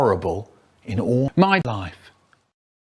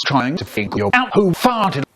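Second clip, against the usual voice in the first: normal talking voice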